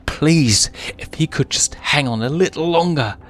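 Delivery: high, loud, pleading voice; desperate plea. Acted. Young lion begging his falling father, desperate. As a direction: beg him to hang on.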